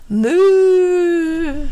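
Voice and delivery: high, loud startled moo; a startled cow hiccuping a moo. A narrator's reading. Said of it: "hickade en ko".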